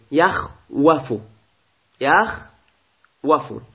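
یخوفو drawn out syllable by syllable, yakhwafu; یخوفو spelt out slowly for the class; slow underlying form. Shown as a demonstration. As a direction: stretch یخوفو very slowly, yakh-wa-fu. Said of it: "از مصدر خوف گرفته شده".

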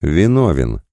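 usual speaking voice

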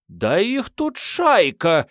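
high, slow wailing shout. Acted. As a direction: wail out nervously. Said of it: "заголосила нервная колхозница".

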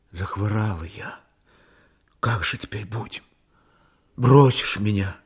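soft low near-whisper; hushed, weak. A narrator's reading. Sick woman confides in her man at night, whispering weakly. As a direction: confide it faintly, almost without voice.

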